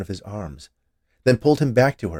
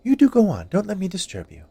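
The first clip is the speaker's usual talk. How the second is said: high, loud teasing woman; woman's voice; 12 semitones higher, 6 dB louder than usual. A narrator's reading. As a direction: brush him off playfully, up high.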